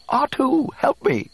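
high, loud cry for help; panicked droid plea. A narrator's reading. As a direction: beg your companion to rescue you. Spinning droid begs for help.

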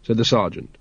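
usual speaking voice

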